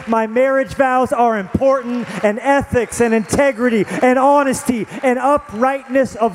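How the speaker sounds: rising passionate preacher voice; preaching at full force. Acted. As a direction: preach it home to the congregation. Preaching climax.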